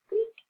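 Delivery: tiny nervous mouse squeak. A narrator's reading. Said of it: "mouse's nervous squeak".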